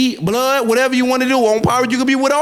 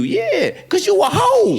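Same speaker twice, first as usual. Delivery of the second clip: drawn-out taunt; 10 semitones higher, slower than usual. Real, not acted. Taunting opponent.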